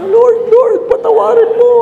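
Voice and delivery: very high, loud hammed-up pleading; exaggerated comic prayer; mock plea. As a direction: beg heaven in comic embarrassment. Priest's embarrassed mock prayer.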